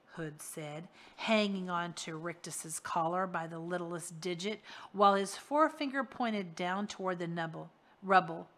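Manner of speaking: usual speaking voice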